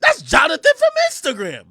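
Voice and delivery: high, loud imitation of his date; weird girl voice. Date revealing who the man is, weird excited voice. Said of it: "she said in a weird way".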